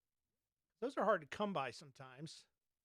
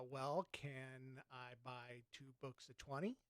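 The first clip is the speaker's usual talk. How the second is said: slow and lower, deadpan; 5 semitones lower, 9 dB quieter, slower than usual. Retold or imitated. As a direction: spell it out for a dim clerk. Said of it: "he says in a slow kind of voice".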